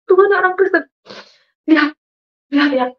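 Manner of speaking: high plaintive call, mildly acted; retold tearful plea. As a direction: beg God as if crying. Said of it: "Saya menangis di belakang kosan". (seruan memelas yang ditirukan)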